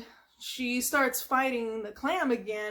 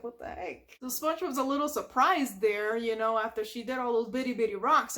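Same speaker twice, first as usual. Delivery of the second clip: ordinary delivery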